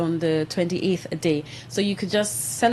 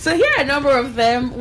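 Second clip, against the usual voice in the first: higher and louder, still laughing; trying to go on while giggling; 6 semitones higher, 5 dB louder than usual. Real, not acted. Host resuming the list while still laughing.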